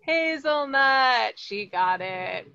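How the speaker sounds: ordinary talk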